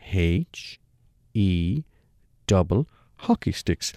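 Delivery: H, E and double hockey sticks spelled out with a pause after each; laboured spelling. Retold or imitated. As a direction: spell it out slowly so a fool could follow. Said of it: "this time a bit more laboured".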